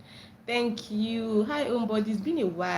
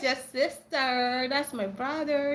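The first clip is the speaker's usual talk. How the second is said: ordinary delivery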